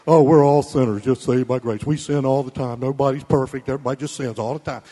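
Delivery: low, loud put-on dopey delivery. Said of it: "Christians run around saying that".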